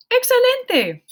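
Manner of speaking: high, loud cheer of "Excelente"; excited praise. Real, not acted. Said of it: "praising students".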